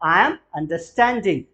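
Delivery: very slow and deliberate; slow model answer. Shown as a demonstration. Teacher models the answer slowly for students. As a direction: lay each word out slowly. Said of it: "मेरे समझ में आ रहा है".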